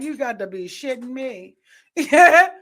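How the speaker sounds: ordinary talk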